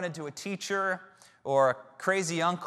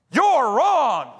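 shouted, much higher and louder; outburst; 9 semitones higher, 10 dB louder than usual. Retold or imitated. Cocky student stands up in class, shouting at professor. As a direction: blurt out an accusation across the room. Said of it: "I full on stand up, and I'm like".

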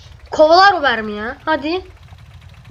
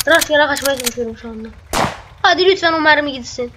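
usual speaking voice

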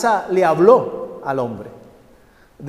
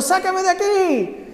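very high, slow cry from inside the oven; desperate scream; 13 semitones higher, slower than usual. Acted. The cup trapped in the hot oven cries out, very high. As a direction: plead to be let out, pitched high. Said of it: "porque yo estaba gritando".

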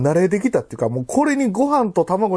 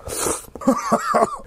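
high squealing laugh; 3 dB quieter than usual. Real, not acted. Laughs after eating spicy food.

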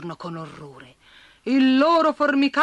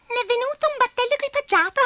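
confused spider; 4 dB louder than usual. A narrator's reading. Abigail after her spell went wrong, high flustered. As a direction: fret over the mistake like a little spider.

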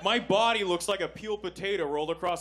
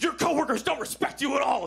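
higher, louder shouted insult; mock dirty talk shout; 10 semitones higher, 4 dB louder than usual. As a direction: yell it half-laughing.

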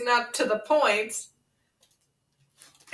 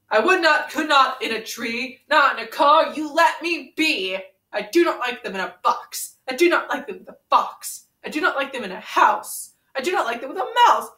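building, emphatic read-aloud, higher by the end; storybook reading; 4 semitones higher than usual. Acted. Reading the refusals to Ren, rising sing-song. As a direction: pound out each refusal like a bedtime story. Reads Dr. Seuss book aloud.

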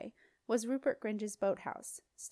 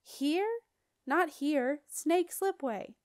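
raised voices; 6 semitones higher, 4 dB louder, much slower than usual. A narrator's reading. Shouted exchange on flying dragon.

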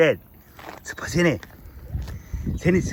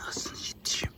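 breathy loving whisper of "Ağzını yediğim"; 14 dB quieter than usual. Real, not acted. Man whispers fondly to his sleepy foal, tender whisper. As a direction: whisper "Ağzını yediğim" softly into the foal's ear.

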